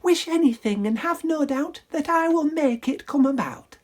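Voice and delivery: high magical fairy. Acted. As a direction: promise it sweetly. Fairy grants wish.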